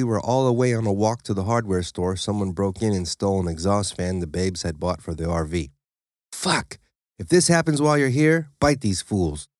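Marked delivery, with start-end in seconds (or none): 6.3-9.5 higher, heated frustration; furious owner giving orders; mild narrated anger; angry. A narrator's reading. Angry after a break-in.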